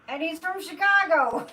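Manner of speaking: laughing through the words, then a real laugh; bursting into a happy laugh. Real, not acted. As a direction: chuckle with delight at the news. Delighted about the Chicago pope.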